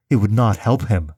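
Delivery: ordinary delivery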